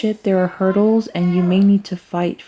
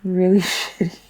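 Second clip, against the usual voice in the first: laughing. Real, not acted. Reader laughing for something else, laughing as she talks. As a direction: laugh breaking into the words. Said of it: "Sorry, I'm laughing for something else".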